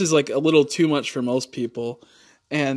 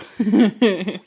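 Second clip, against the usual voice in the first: higher-pitched giggle; knowing little laugh; chuckle; 7 semitones higher, 6 dB louder than usual. Real, not acted. Joking about YouTube's rules, giggling.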